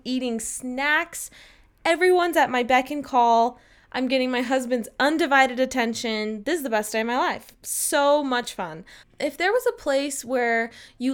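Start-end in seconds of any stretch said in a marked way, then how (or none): none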